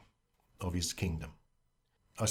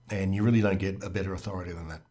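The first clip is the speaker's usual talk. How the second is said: ordinary talk, nothing marked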